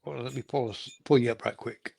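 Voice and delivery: ordinary talk, nothing marked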